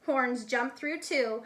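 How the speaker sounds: usual speaking voice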